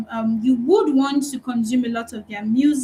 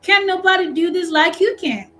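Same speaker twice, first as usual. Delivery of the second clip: imitating Madea; 6 semitones higher, 3 dB louder than usual. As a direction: copy the stern aunt's tune. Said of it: "just like she's gonna say it".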